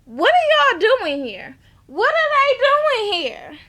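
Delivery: slow, loud, rising to high disbelief; shocked question. Retold or imitated. Mo finding her family there, slow, loud and shocked. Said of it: "Mo is like".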